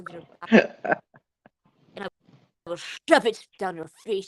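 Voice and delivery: mock Russian threat; put-on aggressive Russian; aggressive Russian accent. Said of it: "was fucking aggressive".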